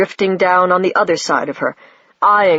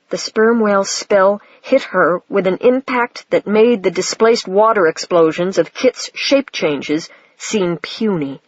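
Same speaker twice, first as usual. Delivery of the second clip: ordinary delivery, nothing marked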